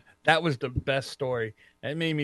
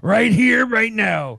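higher, louder wrestler impression; Macho Man; 5 semitones higher, 5 dB louder, slower than usual. Co-host mimicking Macho, loud insistent impression. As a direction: punch it out like the wrestler. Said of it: "you sound like Macho Man".

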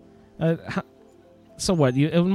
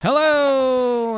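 big show greeting; 10 semitones higher, 4 dB louder than usual. Pretend.

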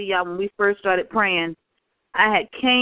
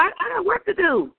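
high, pointed I got work to do; mock-stern high retort; sassy firm reply; 10 semitones higher than usual. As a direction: dismiss her, pitched up. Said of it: "I had to tell her".

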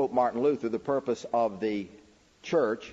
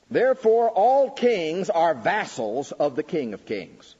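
louder, higher and slow, "all" hit hard; proclaiming the quote; 7 semitones higher, 6 dB louder, slower than usual. Acted. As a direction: ring out "Therefore, all kings" like a decree. Proclaims quote, then restates.

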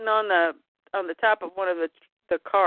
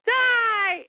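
high hammed-up wail; mock panic cry; 12 semitones higher than usual. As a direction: cry out in fake terror. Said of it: "John goes".

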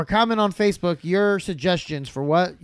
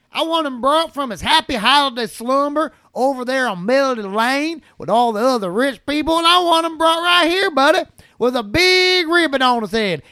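high, ever louder furious tirade; Clark Griswold rant; 6 semitones higher, 3 dB louder than usual. Acted. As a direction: build the insult list into a raging rant. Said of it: "I'm gonna be Clark Griswold".

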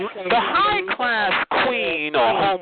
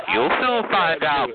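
ordinary delivery

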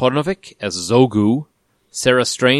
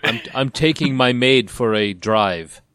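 slow, put-on Austrian action-star voice; Arnold Schwarzenegger; slower than usual. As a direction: draw it out in a mock Austrian accent. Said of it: "Arnold Schwarzenegger in for a cameo".

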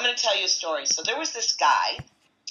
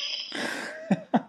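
high breathy laugh. Real, not acted. Laugh after quoting the insult.

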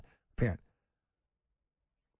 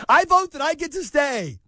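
mock immigrant voter; 7 semitones higher, 4 dB louder than usual. Mocking illegal immigrant voter.

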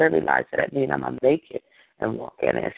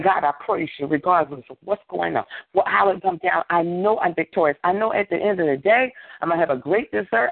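rolling, higher-pitched preacher's prayer; fervent praise prayer; 7 semitones higher than usual. Real, not acted. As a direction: pour out thanks to God in rhythmic phrases. Leads fervent praise prayer by example.

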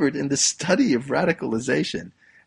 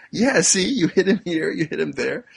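teacher agreeing; 5 semitones higher, 5 dB louder than usual. Retold or imitated. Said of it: "And the teacher says".

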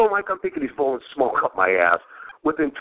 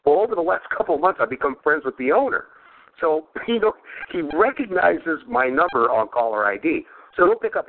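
ordinary talk

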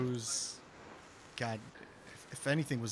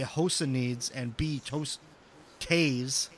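normal talking voice

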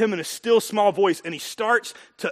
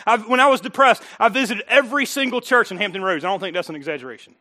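normal talking voice

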